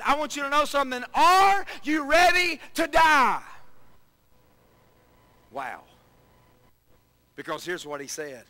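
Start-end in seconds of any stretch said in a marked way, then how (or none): 0.0-3.4 Jesus challenging disciples. Acted. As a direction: hurl the question at them. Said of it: "he looks at his disciples".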